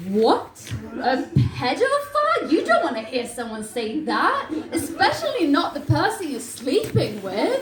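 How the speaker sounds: high, loud squawk of shock; appalled disbelief. Acted. Boyfriend hearing a bedmate's alarming confession, high shocked outburst. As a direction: blurt it out aghast, pitch leaping.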